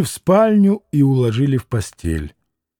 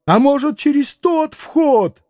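put-on a panicky old man clutching at hope; anxious old man; 10 semitones higher than usual. A narrator's reading. Frantic old father asks.

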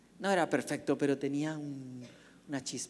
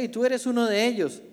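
accusing; 7 semitones higher, 7 dB louder than usual. Acted. Second accuser confronts Peter.

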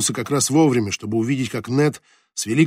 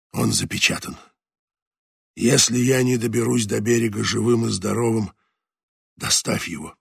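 dying skipper. A narrator's reading. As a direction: speak like a dying man holding on. Voices dying skipper Leclerc.

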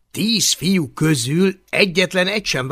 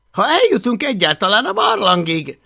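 a touch of higher, louder worry; nervous boy retort; 7 semitones higher, 6 dB louder than usual. Acted. Hablaty nervously eyes the cliff, slightly higher and louder. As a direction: toss in a jittery remark.

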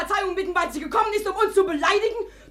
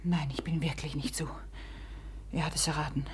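lower, softer secret whisper; whispered confession; 13 semitones lower, 12 dB quieter than usual. Acted. Nastasia confides quietly to the prince, a soft whisper. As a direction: breathe it just to him.

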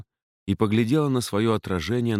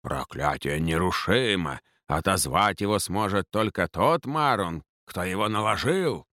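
mocking Faun's voice. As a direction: mimic a solemn faun, puffed cheeks. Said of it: "передразнил голос Фавна".

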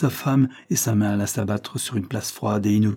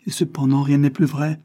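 higher, louder insistence; agitated, pitched-up assertion; distressed; 5 semitones higher, 4 dB louder than usual. A narrator's reading. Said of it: "reprit Joyce en se tordant les mains".

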